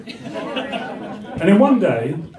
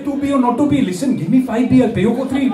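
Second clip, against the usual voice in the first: put-on higher, singsong Indian accent; 6 semitones higher than usual. Indian Shakespeare haggling over a price, Indian accent. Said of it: "have an Indian Shakespeare".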